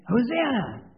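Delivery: Hosanna! called out high and loud; shouted Hosanna. Shown as a demonstration. Pastor leading children, loud shout. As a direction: call out Hosanna! like a cheering crowd. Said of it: "I want you to scream out".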